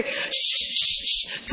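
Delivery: short, sharp shushes; repeated "shh", acted out; mimed shushing. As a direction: hush a baby in quick bursts. Mimics shushing a baby at meetings.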